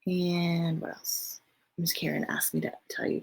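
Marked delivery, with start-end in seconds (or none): none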